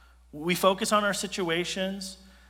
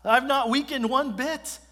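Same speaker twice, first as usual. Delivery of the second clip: much higher and louder, triumphant; God's declaration; 8 semitones higher, 6 dB louder than usual. Acted. God confronting Elijah, higher and louder still. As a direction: proclaim it with full force. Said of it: "confronting Elijah with truth".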